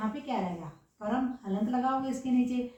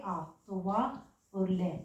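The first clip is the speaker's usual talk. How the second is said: slow spelling out; 3 semitones lower, 3 dB quieter, much slower than usual. Shown as a demonstration. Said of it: "teacher spells out word parts".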